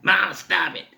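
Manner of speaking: lightly acted high-pitched groan of a mother; a mock high whine. Storyteller mimics a babysitter's groaning mother, high-pitched groan. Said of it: "her groaning mother".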